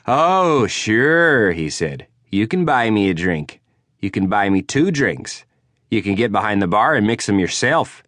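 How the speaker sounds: mock agreement dripping with scorn; sarcastic. Drunk Prudell mocking the offer of a drink, sarcastic.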